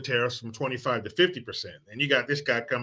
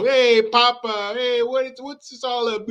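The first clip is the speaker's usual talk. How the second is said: put-on a high, bouncy kid's voice asking Papa; child voice; 8 semitones higher than usual. Grandchild greeting grandpa at his cabin, high kid voice. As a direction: pipe up like an eager grandchild.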